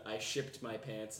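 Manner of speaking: quoted catchphrase. Retold or imitated. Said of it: "my favorite video since the".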